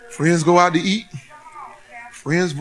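ordinary talk, nothing marked

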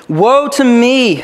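high, loud, drawn-out prophetic cry; quoted cry of woe. Retold or imitated. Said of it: "I cried".

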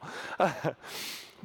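short breathy laugh at himself; quick, self-conscious laugh. Real, not acted. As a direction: chuckle at your own expense. Laughs at wife's teasing about him.

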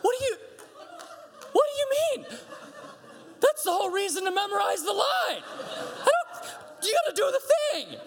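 hammed-up parent losing it at a child; exasperated outburst.

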